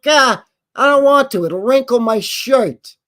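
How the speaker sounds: high, loud whine, slowing at the end; whiny driver. Retold or imitated. Guy in seatbelt ad refusing the belt, high loud whine. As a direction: complain like a spoiled man-child. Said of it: "And the guy's like".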